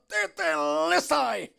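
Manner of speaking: very loud, high, stretched shout; victory cry. Retold or imitated. Preacher re-enacting Christ's final word, victorious shout. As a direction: hurl out the word in one long roar. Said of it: "Dan ketika dia berkata".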